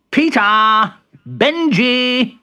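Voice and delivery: high, loud call. Acted. Bertie waking his rabbit friends, loud calling. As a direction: call their names to rouse them. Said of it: "he called".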